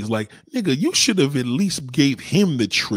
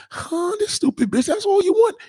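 high, squeaky mock of the boss; a falsetto send-up of a fawning boss. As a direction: jump way up to mock the boss's eagerness.

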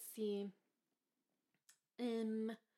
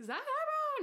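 Efron squealed much higher and louder; swooning fan; 4 dB louder than usual. Retold or imitated. Mocking new fans who gush over the actor: high swoon. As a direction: gush the name like a smitten fan. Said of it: "now people are like".

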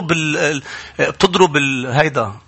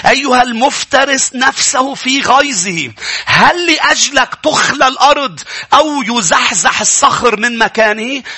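slow, raised scripture reading building to a shout; dramatic reading; 9 semitones higher, 3 dB louder, slower than usual. Acted. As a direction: declaim the verse slowly, rising to a shout. Preacher reads Job aloud, dramatic. (قراءة درامية بطيئة بصوت مرتفع)

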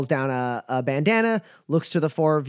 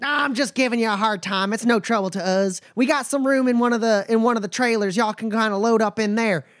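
higher, folksy drawling caravan hand; a wary but friendly trail boss sizing folks up; nomad Bumper; 6 semitones higher than usual. Acted. GM voices Bumper.

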